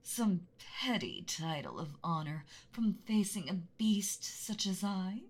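menacing, hushed dragon threat, drawn out; mean angry dragon voice. Acted. Dragon to a trespassing boy, menacing hush. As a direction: loom over him like a beast in its lair. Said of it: "mean, angry dragon voice".

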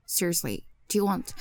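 ordinary talk

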